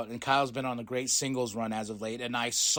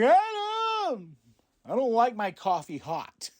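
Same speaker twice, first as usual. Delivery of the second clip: high squeaky pouting voice on Adam; a hammed-up fussy complaint, high then easing; mock whiny Roderick; 9 semitones higher, 5 dB louder, slower than usual. Recapping the skit, Roderick whines at Adam in a high mock voice.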